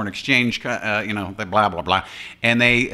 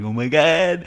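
higher mock-alarmed voice; panicking cereal, imitated; 5 semitones higher, slower than usual. Last Rice Krispies clinging together, mock alarm. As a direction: lightly voice a cereal flake in mock dread.

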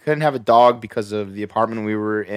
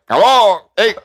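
high, louder squawky parrot imitation; parrot mimicking Miguel; 7 semitones higher, 5 dB louder than usual. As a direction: play the parrot copying Miguel. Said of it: "it sounded exactly like this guy".

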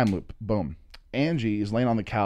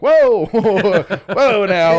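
loud, high, laughing "whoa"; mock alarmed halt; 8 semitones higher, 10 dB louder, much slower than usual. Stopping her blaming him, loud comic alarm. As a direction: call a halt, half laughing. Retold attempt to halt a panicker.

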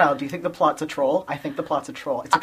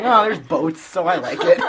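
louder, higher, laughing through the words; laughing self-mockery; 6 semitones higher, 8 dB louder than usual. Podcaster mocking own love of boats, laughing. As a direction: poke fun at yourself while laughing. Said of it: "I'm just like".

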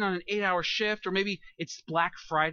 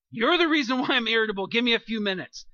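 louder, higher, accusing the other person; blaming snap; 4 semitones higher, 6 dB louder than usual. Shown as a demonstration. Preacher showing the wrong way to speak to a spouse, loud blaming snap. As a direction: throw the blame at them, sharp and loud. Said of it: "Very different, right?".

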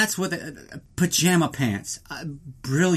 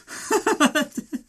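put-on girlish giggle; 13 semitones higher than usual. Giggle of the smitten girl.

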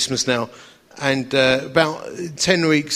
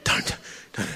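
soft unvoiced whisper; whispered restraint; 9 semitones lower, 8 dB quieter, slower than usual. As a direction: whisper it to yourself. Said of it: "Everything in me was like".

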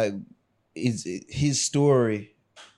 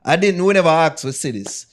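louder and higher, fed-up venting; exasperated rant; 6 semitones higher, 8 dB louder than usual. Real, not acted. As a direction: rant about the group chat. Ranting about explicit videos sent.

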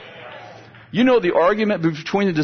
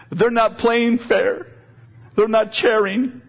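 mock tearful little girl; 6 semitones higher, slower than usual. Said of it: "And she's crying".

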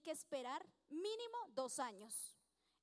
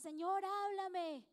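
slow, higher and louder, Señor drawn out like a plea; mock pleading prayer; 5 semitones higher, 7 dB louder, much slower than usual. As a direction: pray it like a churchgoer begging to be spoken to. Imitating a pleading believer's prayer.

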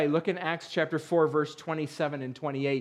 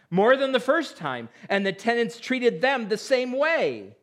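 scripture reading; 3 semitones higher, 3 dB louder than usual. Acted. Reads parable aloud from scripture.

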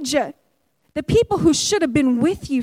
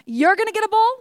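pitched higher, toward them; higher "You're gonna get a bull"; pointing at them; 5 semitones higher than usual. Retold or imitated. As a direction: lift the voice for "you". Elijah pointing at the prophets.

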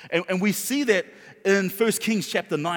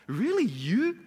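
devil's belittling doubt; 4 semitones higher, slower than usual. Acted. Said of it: "the devil will try to make you doubt".

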